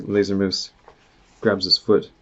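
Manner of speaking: usual speaking voice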